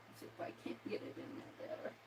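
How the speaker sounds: fast whispered mutter. Real, not acted. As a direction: mutter to yourself under breath.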